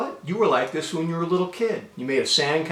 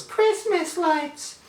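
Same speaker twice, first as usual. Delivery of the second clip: put-on dreamy kid's wonder; much slower than usual. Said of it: "all my father heard me say was".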